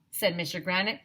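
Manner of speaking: ordinary delivery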